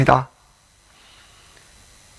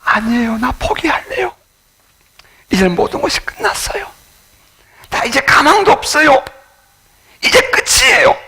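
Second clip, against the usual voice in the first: despairing believer; 12 semitones higher than usual. Retold or imitated. Despairing person answers God.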